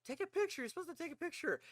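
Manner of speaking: high-pitched mock wife voice; wife urging. Wife directing a new player to take the picture, mock high-pitched urging. Retelling wife's urgent in-game orders.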